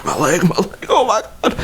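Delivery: high, rising mock panic; freaking out. Retelling his agony to friends, high mock wail. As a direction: imitate wailing over an injury, pitch climbing. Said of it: "I'm freaking out right now".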